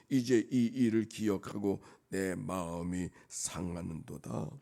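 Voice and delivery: declaimed scripture reading. Real, not acted. Preacher reads the psalm to congregation, declaimed scripture reading. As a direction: proclaim the verse with weight.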